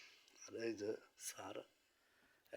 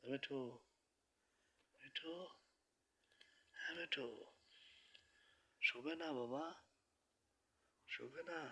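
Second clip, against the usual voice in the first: higher, sweet coaxing call to a pet bird; 4 semitones higher than usual. Real, not acted.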